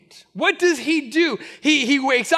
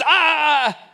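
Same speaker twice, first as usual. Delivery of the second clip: ref cutting him off; 3 dB louder than usual. Retold or imitated.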